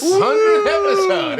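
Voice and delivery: high, loud, drawn-out whoop of celebration; real, laughing cheer; celebratory cheer. Real, not acted. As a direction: cheer the milestone at the top of your voice. Celebrating the podcast's milestone.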